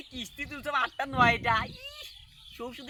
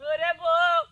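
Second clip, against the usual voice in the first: loud sudden wail; drawn-out, full-voiced lament; theatrical crying; 8 dB louder, much slower than usual. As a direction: howl it out in mock grief. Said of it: "তুই কান্দে গেলু".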